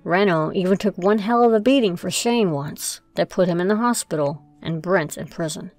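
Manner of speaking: ordinary delivery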